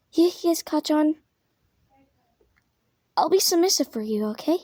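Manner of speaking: high, timid and eager to please; submissive high voice. Acted. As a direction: play it meek and breathy-high. Deku acts submissive to Bakugo.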